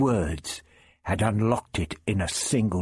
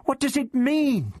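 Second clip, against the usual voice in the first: high, urgent, fully voiced question; anxious girl; 14 semitones higher than usual. A narrator's reading. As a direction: plead for an answer, pitched high. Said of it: "Vicky whispered above the deep".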